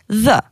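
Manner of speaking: the with a voiced English th; tongue between teeth for the; the as a buzzed "thuh"; Albanian DH sound. Shown as a demonstration. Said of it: "comme le T H en anglais".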